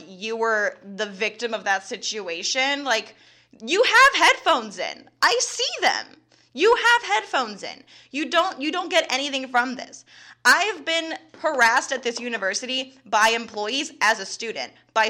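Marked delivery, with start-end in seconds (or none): 3.6-10.0 incredulous, stabbing each word; exasperated outburst. Real, not acted. Venting to the co-host about the professor, high exasperated outburst.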